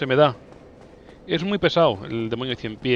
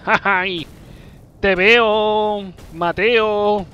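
llamada burlona; 7 semitones higher, 5 dB louder, much slower than usual. Pretend. Llama al enemigo por su apodo.

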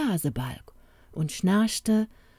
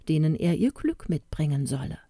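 ordinary delivery

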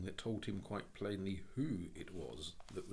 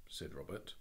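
usual speaking voice

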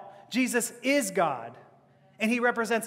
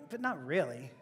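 low, soft doubting aside; quietly unconvinced, almost to yourself; muted disbelief; 7 semitones lower, 9 dB quieter than usual. Acted. Private doubt answering false sympathy.

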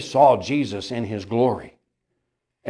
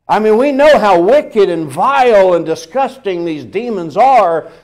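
higher, louder preacher's cry; full-force preaching; 7 semitones higher, 8 dB louder than usual. Acted. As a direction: hammer the word wicked home from the pulpit. Preaching peak.

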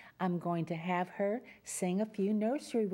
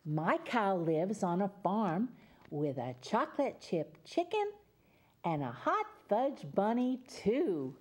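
put-on reading to children; 2 semitones lower than usual. Reads picture book to children.

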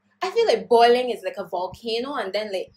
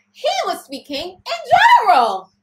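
mock outrage; 9 semitones higher, 12 dB louder than usual. Mocking his excuse, yelling.

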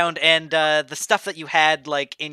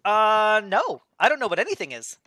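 put-on 1 childlike blob voice; 6 semitones higher than usual. Glob answers.

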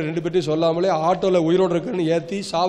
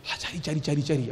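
hushed, low reported assent; imitated meek agreement; 3 semitones lower, 6 dB quieter, slower than usual. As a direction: lightly imitate someone quietly going along with instructions. Woman secretly agrees to his instructions.

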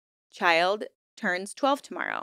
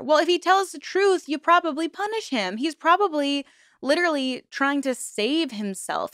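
indignant outburst; 6 semitones higher than usual. Real, not acted. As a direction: push back hard, voice climbing high. Angry rebuttal to the mother's journal.

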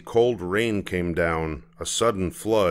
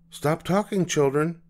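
mother voice; 9 semitones higher than usual. Acted. Mama voiced.

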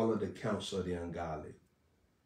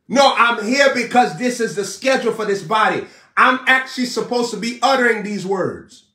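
louder, higher, driving sermon cadence; shouting the message to the congregation; full-force preaching; 10 semitones higher, 11 dB louder than usual. Acted. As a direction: preach it out with rising fire.

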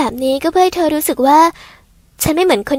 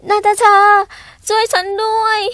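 high, panicked call for help; panicked plea; 5 semitones higher than usual. Acted. Girl whose ears and tail just appeared calls Natasha in panic. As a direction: cry out to Natasha in a fluster.

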